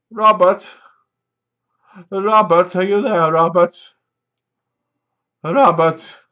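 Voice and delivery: loud mock calling out a name. A group calls out loudly for the missing Robert. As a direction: call for him through the wall.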